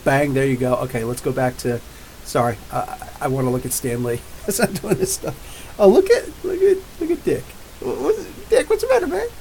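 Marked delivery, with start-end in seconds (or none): 5.8-9.3 much higher squeaky put-on voice; mock high voice. Teasing a friend in a silly high squeak.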